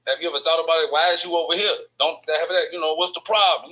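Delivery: acting out an indignant demand; retold confrontation. Acted confrontation with a man at club.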